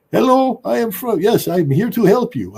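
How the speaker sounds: put-on high, loud Indian accent; bad Indian accent. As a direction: do a hammed-up Indian call-centre helper. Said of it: "my horrible Indian accent".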